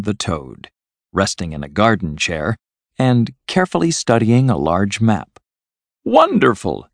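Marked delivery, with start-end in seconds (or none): 6.0-6.9 high, delighted exclamation; delighted cry. A narrator's reading. Toad greets his friends as he sees them, delighted cry. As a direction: cry out in high-pitched welcome. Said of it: "he cried as he saw them".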